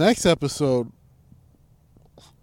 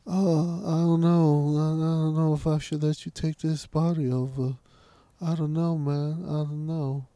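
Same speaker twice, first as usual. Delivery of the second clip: mock British dithering, growing softer; shitty British accent; 5 dB quieter than usual. Mocking the indecisive persona after praise, whiny British accent. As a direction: imitate a hesitant, whiny Brit. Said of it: "talking in the shitty British accent".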